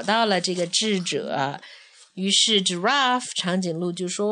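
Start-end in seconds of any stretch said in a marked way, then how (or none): none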